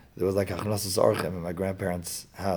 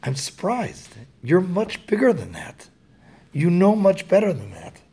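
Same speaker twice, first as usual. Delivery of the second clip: put-on higher, warm praise that lifts the boy; gently proud, build him up; encouraging father; 7 semitones higher, 4 dB louder than usual. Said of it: "what that meant was".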